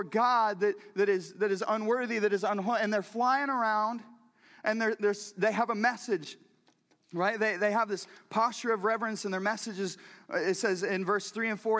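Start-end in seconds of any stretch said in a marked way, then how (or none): none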